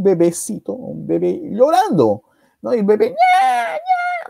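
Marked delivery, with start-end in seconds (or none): 3.1-4.3 high squealing newborn wail; baby crying imitation. Narrator imitates abandoned baby crying, high wail. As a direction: mimic a crying baby on the doorstep. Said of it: "Bueno, esta es mi versión".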